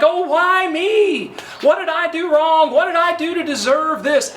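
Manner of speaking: high, loud whining lament; self-pitying cry. Retold or imitated. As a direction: wail it like a self-pitying victim. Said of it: "the natural response is to cry out something like".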